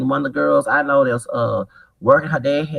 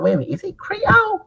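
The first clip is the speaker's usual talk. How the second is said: high surprised quote. Retold or imitated. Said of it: "I said".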